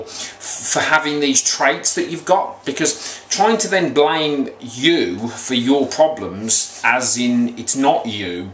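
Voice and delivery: slow, drawn-out and even, like a hypnotist; hypnotic. Real, not acted. Coach talking fans through self-forgiveness, slow hypnotic lull. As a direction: lull the listener with a slow steady delivery. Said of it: "It's a bit hypnotic".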